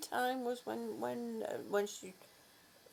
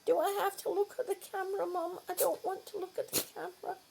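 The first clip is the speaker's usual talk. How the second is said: fast, higher whiny dog voice; voicing the dog; 6 semitones higher, faster than usual. Mother voicing her camera-shy dog, fast and whiny. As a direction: whine it fast like a shy pup. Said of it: "she says".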